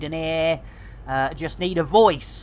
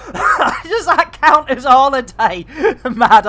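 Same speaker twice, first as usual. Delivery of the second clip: laughing; 7 semitones higher, 7 dB louder than usual. Real, not acted. Laughing at chat joke.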